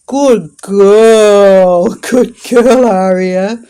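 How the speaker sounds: very loud, delighted praise with a laugh; excited laughing praise. Real, not acted. Owner praising her dog after a gentle take, loud and laughing.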